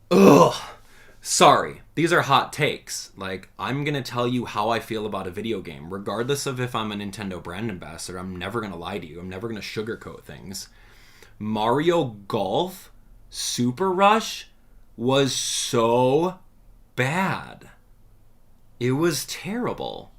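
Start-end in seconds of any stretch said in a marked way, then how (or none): 0.1-0.5 loud, higher-pitched groan of disgust; exasperated groan. Real, not acted. Streamer fed up with Camelot, exasperated groan. Venting about disliked sports games. 11.4-20.1 slow, spaced out for emphasis; emphatic condemnation. Real, not acted. Streamer slamming Mario Golf, slow and emphatic. Ranting about a hated game.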